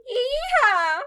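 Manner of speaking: high, loud mock yee-haw; cowgirl whoop.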